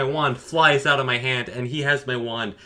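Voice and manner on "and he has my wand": ordinary delivery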